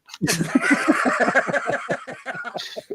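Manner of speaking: real amused laugh; high laugh. Real, not acted. Laughs at own joke.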